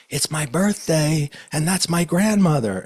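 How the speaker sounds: high, loud excited cry; excited woman. Retold or imitated. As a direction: burst out like the birthday girl. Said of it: "the other one says".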